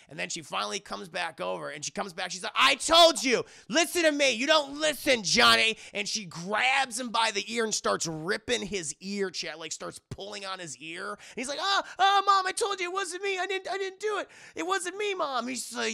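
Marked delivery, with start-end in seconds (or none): 2.6-5.8 high, loud scolding shout; furious mother yelling at her son; angry mom yelling. Retold or imitated. Mom scolding son in retold scene. 11.6-15.5 put-on high, loud whimpering boy; panicked son begging his mom; whiny kid pleading. Son pleading while ear pulled.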